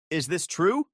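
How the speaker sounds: ordinary delivery, nothing marked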